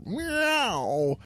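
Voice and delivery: high mock cat meow; playful high cat meow. Cat meow after quote.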